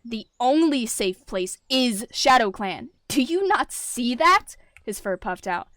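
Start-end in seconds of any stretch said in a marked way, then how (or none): 0.0-4.6 loud, slow, pressing anger; angry. A narrator's reading. Tom insisting ShadowClan is safe, loud and angry. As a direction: hammer it into her. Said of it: "anger in his tone".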